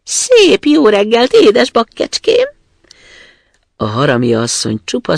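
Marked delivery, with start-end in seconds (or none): 0.0-2.5 very high, loud, slow teasing greeting; sweetly mock her mother with a shout; cheeky greeting. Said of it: "kedvesen köszöntötte". (pimasz, magas, elnyújtott köszönés)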